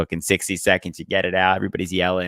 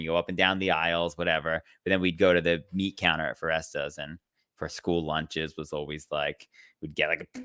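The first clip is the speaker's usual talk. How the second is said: normal talking voice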